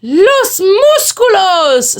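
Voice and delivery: put-on high, loud, slow Spanish-accented cry; chorus of pups. A narrator's reading. Pups answering Skipito together, high drawn-out cry. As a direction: stretch it out triumphantly. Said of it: "said all the papitos".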